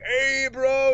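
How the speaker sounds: high, loud bro greeting; imitating a greeting. Dude recognizing him on the street, high cheerful call.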